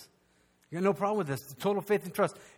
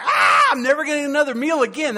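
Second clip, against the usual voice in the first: loud, high mock baby wail; hammed-up crying baby; hungry baby wail; 9 semitones higher, 9 dB louder than usual. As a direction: wail like a starving infant.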